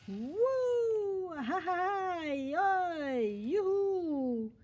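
high, long whoops and yelps; noisy whooping. Acted. Boy noisily charging off to find animals, whooping. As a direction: whoop and holler, drawing each cry out.

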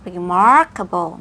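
"remarkable" with heavily curled R, stretched MAR; exaggerated R sounds in "remarkable". Shown as a demonstration. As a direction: curl the tongue hard on R in "remarkable". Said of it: "มี R ใส่หอลิ้นเข้าไปเลย".

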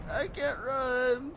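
slow squeaky high whine; comic wailing complaint; high drawn-out wail. Pretend. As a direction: stretch it out very high. Repeats complaint as silly wail.

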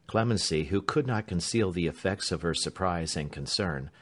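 plain reading voice

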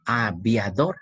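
"Aviador" counted out as a, via, dor; "Aviador" with small gaps, a - via - dor; three separate syllables. Shown as a demonstration. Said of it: "Puedes escribir las tres sílabas".

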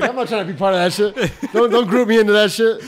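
loud, high, hammed-up whiny protest; mock shout. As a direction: blurt it out loud like a touchy friend being teased. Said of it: "He's like".

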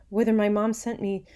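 high, loud earnest appeal; pleading daughter. A narrator's reading. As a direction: implore your father for forgiveness. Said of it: "She's asking her dad".

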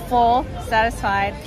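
ordinary delivery

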